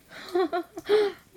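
mocking laugh at her messy girl; burst of laughter. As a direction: crack up delightedly. Laughing at the accident.

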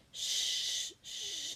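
soft soothing shush; shushing her embarrassed baby girl tenderly. Acted. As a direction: hush her gently. Calming the girl.

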